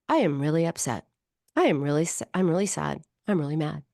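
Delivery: name the feeling without feeling it; flat thinking it. Shown as a demonstration. Therapist contrasting naming a feeling with feeling it. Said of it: "That's just thinking it".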